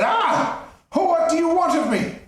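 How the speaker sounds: high, loud frightened cry; terrified miser. Acted. Scrooge crying out in terror as the ghost enters.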